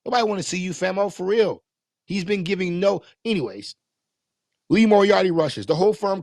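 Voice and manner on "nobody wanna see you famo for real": high mock heckling of Stokely, pitched well up; mocking heckle. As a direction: jeer like a fed-up fan in the seats. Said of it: "Stokely goofy ass come out".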